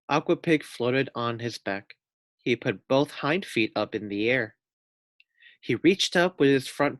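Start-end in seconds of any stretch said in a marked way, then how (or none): none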